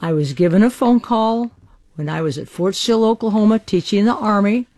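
usual speaking voice

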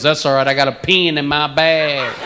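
put-on Texas accent. Austin guy offering a pencil substitute, Texas accent. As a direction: imitate a Texan twang. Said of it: "You talk about accent differences".

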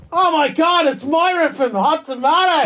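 high excited yell of her name. Retold or imitated. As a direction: shout it like spotting a celebrity. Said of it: "I yell out".

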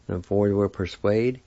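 ordinary talk, nothing marked

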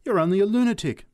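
high, loud, fast dismissal; scornful snap. A narrator's reading. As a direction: round on him with contempt. Big woman rounding on the man.

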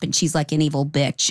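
normal talking voice

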